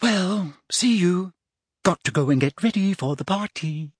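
put-on high, girlish, breezy goodbye; airy farewell. A narrator's reading. Hermione leaving to get ready for the party, airy.

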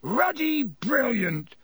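high, slow, drawn-out boom of praise. A narrator's reading. Hagrid over the heads of the Gryffindors, booming. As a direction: stretch it out as a big hearty cheer. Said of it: "boomed Hagrid".

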